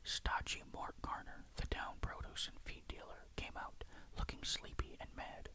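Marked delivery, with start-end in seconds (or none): none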